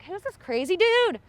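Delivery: high, loud, incredulous question; incredulous reaction. Retold or imitated. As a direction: exclaim it like a baffled onlooker. Voicing Alberta's startled reaction.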